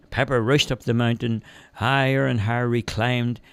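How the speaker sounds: ordinary delivery, nothing marked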